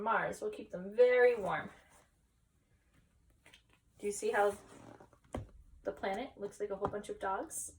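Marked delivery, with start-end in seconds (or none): none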